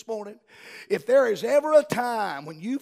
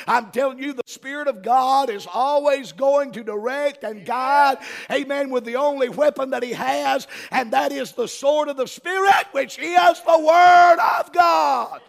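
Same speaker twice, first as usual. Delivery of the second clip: full-force preaching; 5 semitones higher, 9 dB louder than usual. Real, not acted. Preacher to congregation on the sword of the Spirit, building to a shout. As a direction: drive it home, swelling to a roar on the last phrase.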